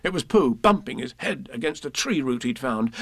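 ordinary delivery, nothing marked

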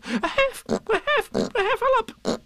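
high squeaky gasps, failing to finish the word; breathless. A narrator's reading. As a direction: squeak it out in winded bursts. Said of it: "breathing so hard that he could hardly speak".

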